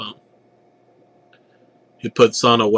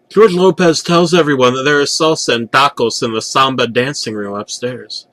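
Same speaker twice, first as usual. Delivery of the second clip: ordinary delivery, nothing marked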